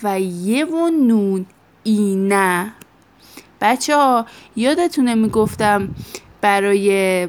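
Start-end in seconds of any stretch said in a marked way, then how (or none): 0.0-2.7 slow, spelled-out teacher dictation of "یه و نون"; dictated ending. Shown as a demonstration. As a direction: say "و یه و نون اینه" slowly and clearly for notes. (دیکته شمرده و آهسته)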